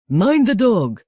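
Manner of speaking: stern warning. Acted. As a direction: bark a warning at them. Captain threatens.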